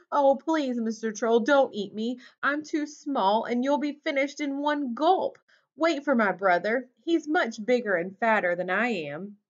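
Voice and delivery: high, pleading little goat; high-pitched storybook plea; trembling little goat. A narrator's reading. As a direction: beg the troll for your life. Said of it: "Little Billy Goat Gruff trembled".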